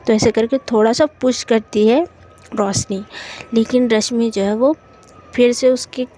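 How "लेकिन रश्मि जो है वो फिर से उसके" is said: plain reading voice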